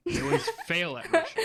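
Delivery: breaks into a high laugh. Real, not acted. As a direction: laugh through the punchline. Laughs mid-sentence.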